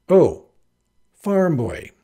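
loud, high mock recognition; feigned surprise. Heroine pretends to just recognise the farm boy, feigned surprise. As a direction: exclaim as if only now realising.

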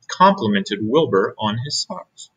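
ordinary delivery, nothing marked

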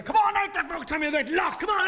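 high rowdy street yell; drunken shout. Retold or imitated.

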